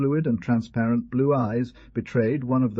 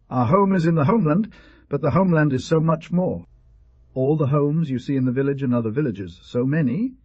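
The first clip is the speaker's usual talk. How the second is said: high, louder, emphatic father's voice; animated explaining father; 6 semitones higher, 5 dB louder than usual. A narrator's reading. Father telling his son what the homeland is, animated. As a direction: explain with lifted energy, pitch well up.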